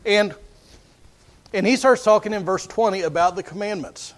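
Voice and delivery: normal talking voice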